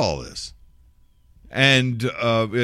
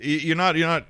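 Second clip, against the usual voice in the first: ordinary talk, nothing marked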